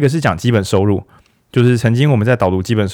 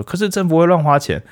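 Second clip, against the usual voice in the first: higher-pitched imitation of an objector; light mock protest in a raised pitch; mock objection; 8 semitones higher than usual. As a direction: pipe up as the doubter, pitched well up. Voices a critic's objection.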